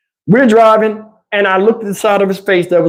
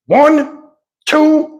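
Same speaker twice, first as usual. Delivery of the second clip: higher, drawn-out call of one, two; mock countdown; 9 semitones higher than usual. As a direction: call the count across the pen. Counting down to open gate.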